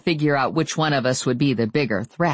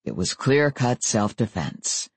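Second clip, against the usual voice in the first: ordinary delivery, nothing marked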